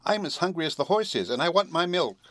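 high little cat voice; kitten voice. A narrator's reading. Hungry kitten demanding milk, in a high voice. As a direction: complain as a small high-pitched kitten. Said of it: "asked Eureka".

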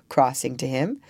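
usual speaking voice

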